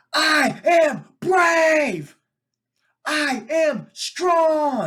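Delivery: high, slow, loud shouted affirmations; screaming affirmations. Retold or imitated. Dad imitating son screaming affirmations in the park, loud and slow. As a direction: belt it out like a proud little kid. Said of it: "Scream out top of your lungs".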